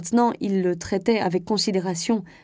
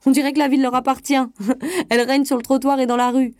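fast, higher, with a chuckle; laughing at his own joke; 6 semitones higher, 6 dB louder, faster than usual. A narrator's reading. Marin amused by his own jab at women, quick and laughing. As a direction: rattle it off amused at yourself. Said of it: "se mit à rire".

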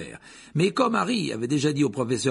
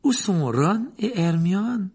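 high, loud, slow inquiry; Lupin; 10 semitones higher, 5 dB louder, much slower than usual. A narrator's reading. Professor asks Harry about his friends, lightly. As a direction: draw the question out in a raised voice. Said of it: "d'un ton très différent".

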